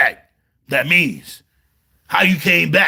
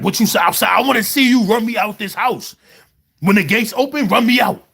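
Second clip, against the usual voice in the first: higher, raised mock yell of a jail rival; retold jail challenge; 6 semitones higher than usual. Rival inmate daring him at the gates, mock shouting. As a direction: throw it at him like a dare across the yard. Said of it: "he was like".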